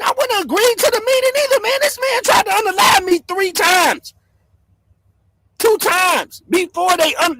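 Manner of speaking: worked-up vlogger raging at a rival; heated rant. Real, not acted. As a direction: rant it out in disbelief.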